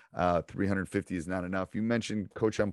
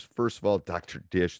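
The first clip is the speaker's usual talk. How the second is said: usual speaking voice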